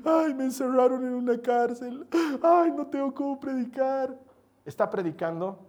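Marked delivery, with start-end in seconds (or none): 0.0-4.2 higher, slowing whimper of a sorry prisoner; mock self-pitying whine. Preacher mocks a prisoner who would weep instead of preaching, whining. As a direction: ham up the poor-me lament, sighing ay. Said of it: "en lugar de estar llorando".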